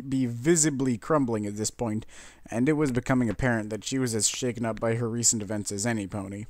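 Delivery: plain reading voice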